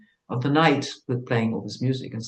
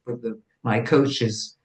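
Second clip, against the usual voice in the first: usual speaking voice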